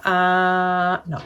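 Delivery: normal talking voice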